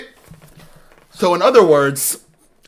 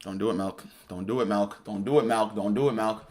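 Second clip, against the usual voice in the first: soft, low, repeated begging under the breath; quiet pleading; 6 semitones lower, 11 dB quieter than usual. Real, not acted. Pleading with game character.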